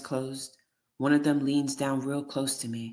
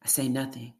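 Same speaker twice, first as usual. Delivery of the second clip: ordinary delivery, nothing marked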